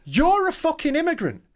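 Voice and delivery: high, drawn-out mock outrage; slow, high-pitched comeback to a hypocrite; incredulous retort. British tourist answering an expat tour guide, incredulous retort.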